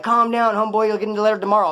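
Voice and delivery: retold holler to calm someone; calling out. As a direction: call over to settle him down, high and loud. Calls to raging cell neighbor.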